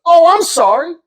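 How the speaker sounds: high, sweetly apologetic voice; nice drug dealer. Retold or imitated. Drug dealer apologizing to his neighbor, high and friendly. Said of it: "actually a nice drug dealer".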